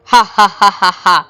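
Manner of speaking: a ghost's mocking ha ha ha through the speaker; eerie cackle. Ghost laughing at the fleeing husband from the car speaker, eerie cackle. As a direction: cackle at him as he drives off. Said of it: "ఆమె మాటలే... స్పీకర్లోంచి".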